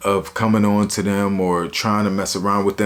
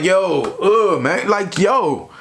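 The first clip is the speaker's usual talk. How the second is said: retold disgusted protest; 8 semitones higher, much slower than usual. Retold disgust at cellmate's farting.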